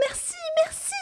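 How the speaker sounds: put-on very high squeaky child's thanks; very high child's cry of thanks; little girl crying out. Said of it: "crie la petite fille".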